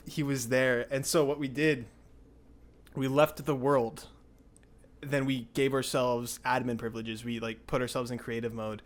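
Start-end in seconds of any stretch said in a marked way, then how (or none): none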